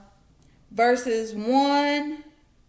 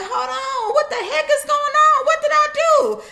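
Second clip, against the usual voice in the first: high, loud, exasperated protest; a bewildered man crying out to heaven; Job's anguished cry; 14 semitones higher, 5 dB louder than usual. Acted. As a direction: rise up and demand an answer. Job's cry retold in sermon.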